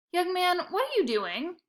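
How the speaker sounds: lightly voiced sharp old lady calling out; shrill old lady. Old Missus Prince calling to the bag boy, lightly shrill. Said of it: "shrill voice".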